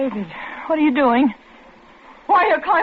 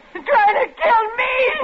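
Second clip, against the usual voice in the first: slow, high crying shout; sobbing scream; 9 semitones higher than usual. Acted. Wife screams while the madwoman attacks her. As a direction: wail it in terror.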